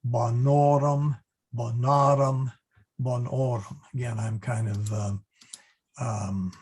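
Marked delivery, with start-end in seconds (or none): 0.1-3.8 bonorum, bonarum hit hard on the middle syllable; extra-hard stress. Shown as a demonstration. Teacher emphasizing the genitive difference, hard middle-syllable stress. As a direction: lean long on NOR and NAR in bonorum, bonarum. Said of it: "hitting it extra hard just to emphasize".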